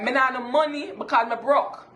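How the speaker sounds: "Me nah no money" in Jamaican Patois, "broke" as "bruck". Shown as a demonstration. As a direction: rattle it off like a yardie.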